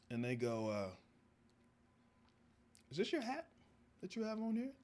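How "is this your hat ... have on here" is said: high, prim questioning voice; mock security guard. Mall guard quizzing him about the hat, high and prim. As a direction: play the polite suspicious guard.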